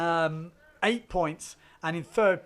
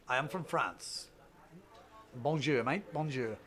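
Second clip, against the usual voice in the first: badly faked French accent; low, soft attempt at a French accent; 4 semitones lower, 7 dB quieter than usual. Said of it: "That was my French accent. It was terrible".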